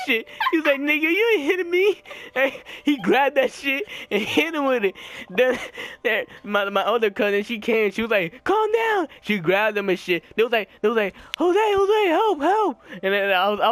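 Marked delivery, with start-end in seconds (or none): 0.8-2.0 high-pitched mock defiance; high, taunting fight talk; indignant retort, imitated. Acted quote in a cousins' fight. 8.4-9.1 very high, mimicking cousin; high-pitched mock alarm; imitated urgent plea. Cousin breaking up the fight. 11.4-12.8 high-pitched imitated panic; high, frantic mimicry; mock cry for help. Acted calls for help.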